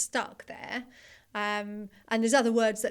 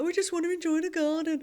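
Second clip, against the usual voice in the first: high put-on Brummy; mock Birmingham accent, pitched well up; 7 semitones higher, 4 dB louder than usual. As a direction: imitate mum doing the advert in Brummy. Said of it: "she'd do a really good Brummy accent".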